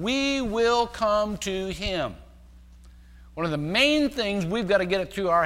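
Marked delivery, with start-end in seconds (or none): 0.0-2.2 "We will come to him", each word drawn out; slow emphatic repetition. Real, not acted. Repeats verse for emphasis.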